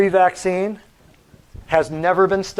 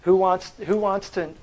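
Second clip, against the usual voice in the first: ordinary delivery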